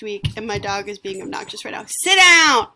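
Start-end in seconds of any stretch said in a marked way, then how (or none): 1.9-2.7 loud, high-pitched command at the dog; real yell. Real, not acted. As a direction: yell sharply at a misbehaving dog. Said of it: "while I yell at my dog".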